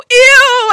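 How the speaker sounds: high, loud, drawn-out "ew"; disgusted squeal. Retold or imitated. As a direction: shriek it in theatrical revulsion. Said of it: "I'm just so disgusted".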